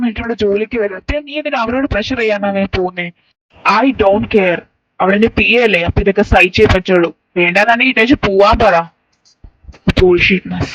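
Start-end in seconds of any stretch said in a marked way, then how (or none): 3.8-10.7 loud, scornful defiance; arrogant anger. Acted. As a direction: throw it back with contempt. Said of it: "എന്തൊരു അഹങ്കാരം Dani നിനക്ക്".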